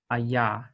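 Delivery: "a ya" with a gliding Y; demo of Y. Shown as a demonstration. Teacher to students, sounding out the yod. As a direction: glide into the Y in "a ya". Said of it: "like a Y sound".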